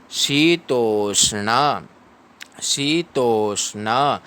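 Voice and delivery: शीतोष्ण with a long ee in shee; slow recitation. Shown as a demonstration. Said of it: "तृतीय चरण में चलेंगे".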